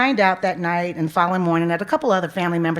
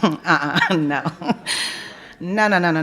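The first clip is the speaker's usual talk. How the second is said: laughing no, no, no; laughing disbelief. Real, not acted. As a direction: laugh it off, refusing to believe. Laughing off unbelievable brother news.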